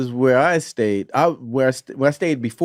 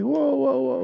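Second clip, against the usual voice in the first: mock surprise; 11 semitones higher, 3 dB quieter, slower than usual. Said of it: "it's like".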